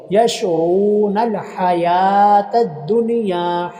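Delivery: slow chanted recitation, हा held long; Quran recitation. Shown as a demonstration. Teacher reciting Quran for students, slow drawn-out chant. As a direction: recite slowly, stretch the हा. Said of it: "या के ऊपर खड़ी ज़बर".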